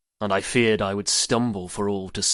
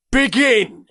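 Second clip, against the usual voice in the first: sharp high shout; shouted command; 14 semitones higher, 7 dB louder than usual. Acted. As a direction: bark the start order. Gaius starts the flogging.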